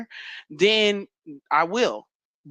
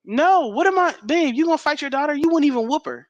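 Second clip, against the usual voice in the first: incredulous protest; 8 semitones higher than usual. Real, not acted. Wife scoffing at husband's claim, high protest. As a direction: call his bluff, voice up high.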